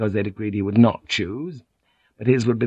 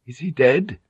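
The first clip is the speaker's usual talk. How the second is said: ordinary delivery, nothing marked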